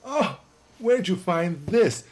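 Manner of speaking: high, slow exclaimed Oh, where'd you find this; delighted surprise. Retold or imitated. Actress shown her old photos, surprised and high. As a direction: gasp of pleased surprise. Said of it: "she'd say".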